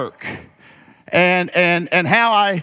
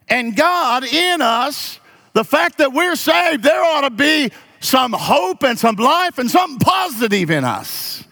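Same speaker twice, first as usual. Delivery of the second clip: preaching shout; 6 semitones higher than usual. Acted. Pastor urging the church to have hope, preaching shout.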